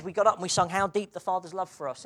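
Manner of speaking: ordinary talk, nothing marked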